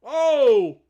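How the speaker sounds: sudden loud startled cry; surprised shout. Real, not acted. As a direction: shout out in shock. Kicker slips during live play.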